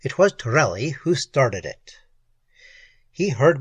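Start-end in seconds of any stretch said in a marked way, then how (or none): none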